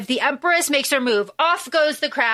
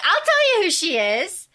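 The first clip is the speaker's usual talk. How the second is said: high, gloating tease; savouring it with a raised, taunting lilt; relishing empress; 8 semitones higher than usual. Acted. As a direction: dangle the secret, voice lifting higher. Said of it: "the Empress is eating this up".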